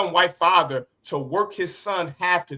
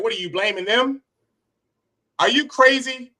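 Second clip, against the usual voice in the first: indignant; 6 semitones higher than usual. Real, not acted. Preacher scolding the congregation.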